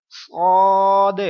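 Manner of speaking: teacher shows صاد as a heavy letter; full heavy letter. Shown as a demonstration. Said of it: "پر پڑھوں گا".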